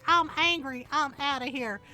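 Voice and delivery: storming-off Southern kid impression; Southern accent. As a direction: imitate a huffy teen with a Southern twang. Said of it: "don't have a southern accent, but she does".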